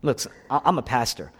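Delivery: ordinary talk, nothing marked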